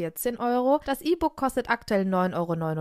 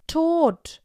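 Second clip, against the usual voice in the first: higher, louder one-word exclamation on TOT; dramatic announcement; 6 semitones higher, 6 dB louder than usual. A narrator's reading. Barbara tells her friends of a death, dramatic exclamation. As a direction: drop the bombshell with a high, loud TOT.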